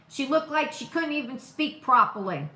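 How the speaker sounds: ordinary delivery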